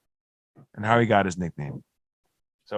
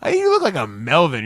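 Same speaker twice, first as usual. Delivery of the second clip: high, loud mocking drunk voice; drunk friend teasing; 9 semitones higher, 4 dB louder than usual. Drunk Doug teasing his friend at the shore house. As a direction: tease him up high like a tipsy buddy. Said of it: "He's like".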